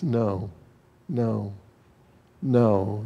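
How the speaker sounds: soft, low no's with space between; quiet, firm refusal. Retold or imitated. Preacher voicing Jesus rejecting the wrong assumption, quietly firm. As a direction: repeat each no quietly, letting it settle. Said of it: "Jesus comes right out and says".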